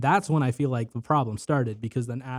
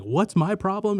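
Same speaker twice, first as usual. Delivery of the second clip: retold manic challenge; 8 semitones higher, 4 dB louder, slower than usual. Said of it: "fucking maniacal laugh".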